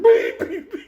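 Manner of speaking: high, loud beeps breaking into laughter; loud alarm beep. Retold or imitated. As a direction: blare like an alarm clock. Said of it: "like a fucking alarm clock".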